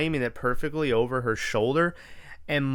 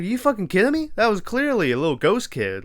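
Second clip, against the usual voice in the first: higher-pitched mock outrage at the boyfriend; incredulous outburst; 8 semitones higher, 3 dB louder, faster than usual. Mocking the boyfriend's excuse, incredulous and higher. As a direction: throw your voice up in disbelief at him. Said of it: "And I was like".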